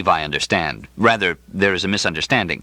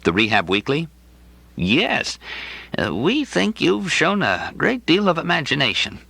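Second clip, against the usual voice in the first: higher, genial voice of the coughing clinic director; amiable director; 6 semitones higher than usual. Acted. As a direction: play the bulging-eyed director, kindly and a bit vague.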